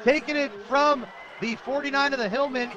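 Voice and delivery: high, loud near-miss call; excited play-by-play. Real, not acted. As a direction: punch it out at full excitement. Live call, runner nearly scores.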